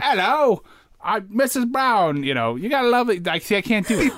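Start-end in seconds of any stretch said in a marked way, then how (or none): 0.0-2.2 high, slow mock Cockney; hammed-up Cockney, pitched up and drawn out; attempted Cockney accent. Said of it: "that's what I was trying to do".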